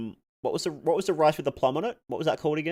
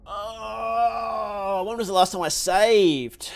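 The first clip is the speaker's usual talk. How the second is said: dismayed slow question; 9 semitones higher, 4 dB louder, much slower than usual. Real, not acted. Dreading lost progress after death.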